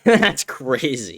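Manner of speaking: amused disbelief with a laugh. Real, not acted. Host reacting to a viewer's Fortnite joke, laughing.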